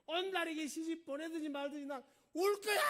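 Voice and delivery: high, loud bratty shout; hammed-up childish tantrum; defiant crybaby princess. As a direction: bawl back stubbornly at father. Acted crybaby princess defying her father.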